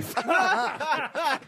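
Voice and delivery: short burst of laughter. Real, not acted. Contestant laughs off her annoyance with the panel. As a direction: break into a laugh. Laughs after mock swearing at others.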